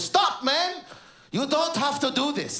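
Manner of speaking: high, loud-edged plea to stop; exasperated snap. Retold or imitated. Man stopping the comic's bad Arabic, exasperated. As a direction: beg him to quit, snapping. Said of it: "like a car crash".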